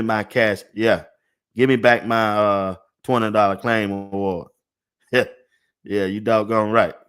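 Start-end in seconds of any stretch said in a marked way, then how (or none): none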